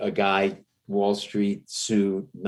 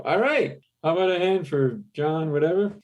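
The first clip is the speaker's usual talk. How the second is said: higher, put-on announcer voice; mock emcee hype, pitched up; club emcee; 10 semitones higher than usual. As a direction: introduce the next act like a club host. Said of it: "hear the M C go up".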